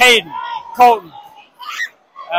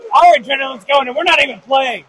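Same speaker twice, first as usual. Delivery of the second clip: usual speaking voice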